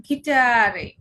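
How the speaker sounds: "গিটারে" loud, long "aa", ending in "-reh"; German pronunciation. Shown as a demonstration. Teacher demonstrating German G to learners, German pronunciation. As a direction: stretch "গিটারে" out as gi-TAA-reh. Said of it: "ডয়েসে গিটারে".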